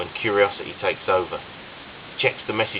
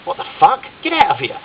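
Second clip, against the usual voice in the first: shrieking, much higher and louder; scream; 7 dB louder than usual. A narrator's reading. Phil caught by friends, screaming at them. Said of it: "screams Phil".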